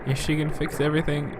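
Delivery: ordinary delivery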